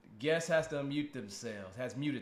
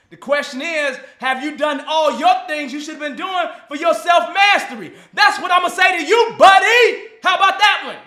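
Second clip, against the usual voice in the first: much louder, higher hammed-up rant; playful mock yell at his buddy; mock shout; 11 semitones higher, 18 dB louder than usual. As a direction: bellow it like a drill sergeant. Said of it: "That is so stupid".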